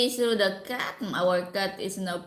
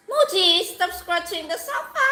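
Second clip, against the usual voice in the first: higher, louder hushing rebuke; scolding the cat; 9 semitones higher, 5 dB louder than usual. Real, not acted. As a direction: shush the cat off the sofa.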